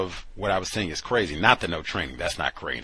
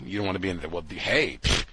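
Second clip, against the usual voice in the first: ordinary talk, nothing marked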